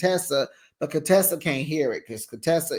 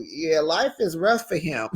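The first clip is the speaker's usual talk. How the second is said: ordinary delivery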